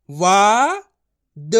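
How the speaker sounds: वा held long and loud, then a separate द; letter by letter. Shown as a demonstration. Teacher modelling his method for learners, letter by letter. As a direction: sound out वा ... द with a pause between. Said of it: "एक एक अक्षर का उच्चारण करके पढ़ रहा हूँ".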